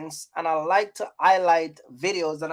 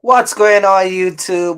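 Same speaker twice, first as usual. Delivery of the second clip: a big, loud hello to the live chat; loud greeting; 10 dB louder than usual. Real, not acted. Streamer hails viewers arriving in the live, loud call. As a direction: call out to everyone joining the stream.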